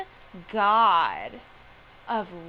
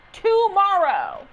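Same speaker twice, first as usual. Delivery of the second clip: Tomorrow pitched up high, slow and loud; excited tomorrow; 9 semitones higher, 9 dB louder, slower than usual. Real, not acted. Streamer repeating banner date to Chris, high excited squeal. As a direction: squeal Tomorrow like it can't come fast enough.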